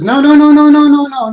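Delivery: loud, high rapid string of no; emphatic no. Retold or imitated. Richard's mentor loudly cutting off the answer. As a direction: cut him off hard. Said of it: "He goes".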